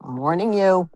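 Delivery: half-serious scolding of the dogs; mock stern warning. Real, not acted. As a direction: warn the dogs.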